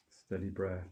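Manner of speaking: normal talking voice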